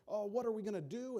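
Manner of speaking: panicked servant. Retold or imitated. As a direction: gasp it out like a frightened servant.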